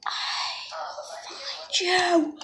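soft hunting whisper; creepy whisper. Pretend. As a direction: whisper like a stalking predator. Monster hunting prey.

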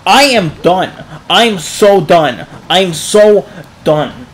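loud, slow, emphatic declaration; exasperated, drawn-out giving up; fed-up insistence. Real, not acted. Gives up in frustration.

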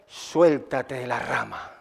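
low, slow, very soft order; God's quiet command. Retold or imitated. God telling the climber to let go, quiet command. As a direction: drop low and hushed, word by word. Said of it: "Entonces le dice".

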